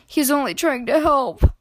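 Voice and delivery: loud, mock-weepy cat; weeping cat. A narrator's reading. Cat defending the missing duck, mock weeping. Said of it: "wept the cat".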